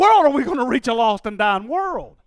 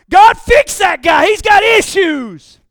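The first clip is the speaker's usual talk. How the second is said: loud, slow mock prayer, pointing at someone else; mock demanding prayer; 6 semitones higher, 11 dB louder, slower than usual. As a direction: demand God fix that guy, hammed up. Mock prayer about another.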